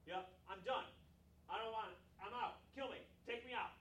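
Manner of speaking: loud, high melodramatic quitting; a parent mimicking his boy's tantrum, loud; imitating sulky son. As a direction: overblown kid meltdown voice. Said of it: "that's exactly what my boy says".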